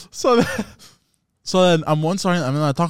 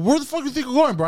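high, indignant retold challenge; aggressive confrontation; 10 semitones higher, faster than usual. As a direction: challenge him with pitch jumping up. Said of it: "I get all aggressive with him".

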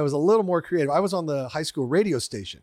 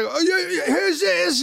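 put-on higher English accent for the Dutch band; a fake English rocker voice on the phone; hammed-up English accent, much higher; 4 dB louder than usual. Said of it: "I was doing an English accent".

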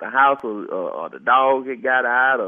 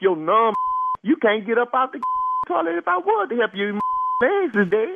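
higher, laughing through the words; 7 semitones higher than usual. Acted. Neighbor laughing at numb-legged caller, laughing high. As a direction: crack up at the absurd request.

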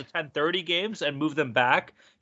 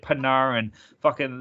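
ordinary talk

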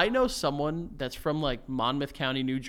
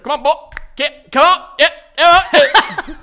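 high, loud mock cowboy herding calls; cattle herding; 12 semitones higher, 14 dB louder than usual. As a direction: holler as if driving cattle, hammed-up. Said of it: "talking to his kids like they're cattle".

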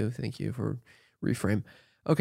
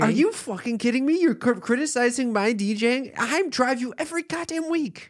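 mock outrage; 10 semitones higher than usual. Real, not acted. Mock quarrel over music.

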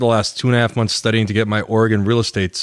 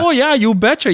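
put-on Minnesota accent; 3 dB louder than usual. Joins friend's Fargo accent bit.

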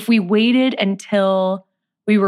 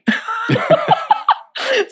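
high laugh. Real, not acted. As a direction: burst of high giggling laughter. Laughs after cheering.

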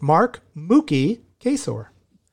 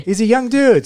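excited; 10 semitones higher, 6 dB louder than usual. Real, not acted. Echoing co-host with emphasis.

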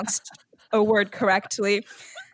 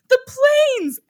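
much higher, louder mock triumph; triumphant answer; 7 dB louder than usual. Punk finally gets the quiz right, with hammed-up triumph. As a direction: blurt it out like a winning answer. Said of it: "Punk is like".

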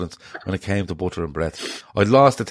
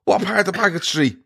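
higher-pitched officious quizzing, hammed up; mock traffic warden; 10 semitones higher than usual. Friend turned traffic warden grilling Gar about parking, mock officious. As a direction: play a nosy traffic warden grilling him. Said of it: "fucking traffic warden appears".

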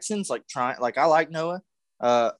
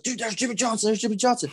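put-on excited kid; 9 semitones higher than usual. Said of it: "And I was like".

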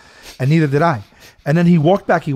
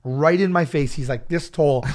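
ordinary talk

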